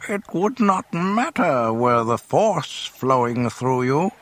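high, slow, amused creature voice; Yoda. A narrator's reading. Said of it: "the amused Yoda answered".

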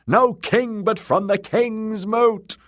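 high, loud chant stretching out at the end; very high, drawn-out rallying cry; crowd shout. Acted. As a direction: roar it as one clamouring mob. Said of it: "they shouted".

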